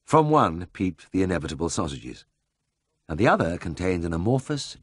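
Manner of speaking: usual speaking voice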